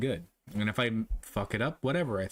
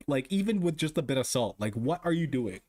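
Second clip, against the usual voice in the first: ordinary delivery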